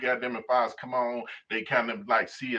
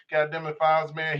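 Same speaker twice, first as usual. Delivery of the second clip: ordinary talk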